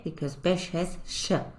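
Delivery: usual speaking voice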